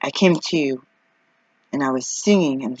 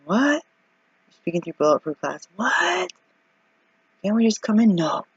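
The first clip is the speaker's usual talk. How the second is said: mock exchange; 7 semitones higher, slower than usual. Arguing through bulletproof glass.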